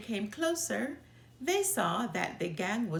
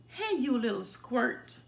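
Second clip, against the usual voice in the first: mild yell; 3 semitones higher, 3 dB louder than usual. A narrator's reading. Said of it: "yelled Too Tall".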